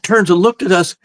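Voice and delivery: plain reading voice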